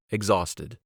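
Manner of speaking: ordinary delivery, nothing marked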